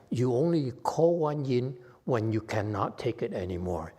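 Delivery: usual speaking voice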